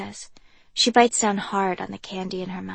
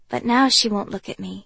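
ordinary delivery

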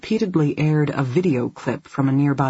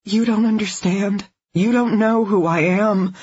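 desperate plea; 6 semitones higher than usual. A narrator's reading. Captive pleading desperately.

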